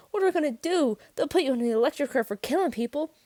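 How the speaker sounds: high, loud panic; near scream. A narrator's reading. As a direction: blurt it out in rising terror. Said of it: "I nearly screamed".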